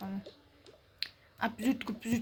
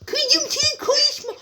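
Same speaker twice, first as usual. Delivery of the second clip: high, slow whiny parroting; mocking imitation; 12 semitones higher, 4 dB louder, slower than usual. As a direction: parrot her words back to mock her. Mimics the mother's line mockingly.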